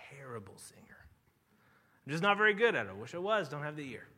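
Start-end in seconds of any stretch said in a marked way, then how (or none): 0.1-1.0 sheepish whisper. Real, not acted. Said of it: "I am a terrible singer".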